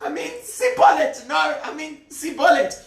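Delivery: very high, comic frantic stammer; flustered squeaky panic. Acted. Captive keeps failing the guards' password test, squeaky panic. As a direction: keep correcting yourself in a squeaky panic.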